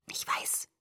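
very soft, voiceless whisper. A narrator's reading. Boy admits it to angry friend at night, whisper. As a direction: whisper it, barely audible. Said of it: "flüsterte er".

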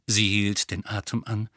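usual speaking voice

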